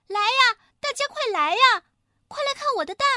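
high, loud, excited little hen; joyful calling out. A narrator's reading. Little hen calls the flock to see her egg, joyful shouting. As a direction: call everyone over in a high, gleeful voice. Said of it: "快活地大叫".

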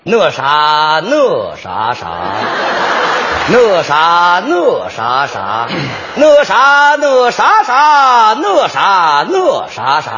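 slow, dramatic wordless recitation. Pretend. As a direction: declaim nonsense like a poem. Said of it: "您能念念吗？".

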